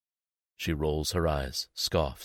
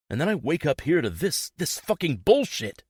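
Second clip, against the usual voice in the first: raised, high angry voice; yelling; 8 semitones higher than usual. A narrator's reading. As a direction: rant it out, rising. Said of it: "Don't start yelling at me".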